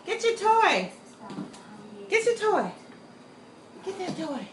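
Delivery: pet talk. Retold or imitated. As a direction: replay the sing-song pet call. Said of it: "I said".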